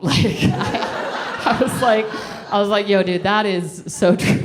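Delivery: laughing through the words; giggling, loud agreement. Retold or imitated.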